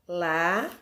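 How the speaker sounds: "Lá" held alone as a single beat; isolated syllable. Shown as a demonstration. Teacher dividing a word for pupils, isolated syllable "Lá". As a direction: sound out "Lá" as one separate, emphatic syllable. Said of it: "dividir a palavra, olha lá".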